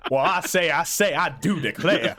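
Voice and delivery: loud, blustery Southern rooster voice; Foghorn Leghorn. Retold or imitated. Game master mocking the players' joke, blustery rooster voice. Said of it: "has a foghorn leghorn".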